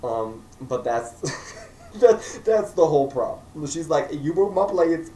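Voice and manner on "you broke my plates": higher, louder mock sass; sassy. Emma scolding Henry over broken plates, sassy. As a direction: give it attitude like a sassy heroine. Vlogger voices Emma's sass.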